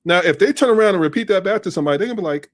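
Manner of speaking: normal talking voice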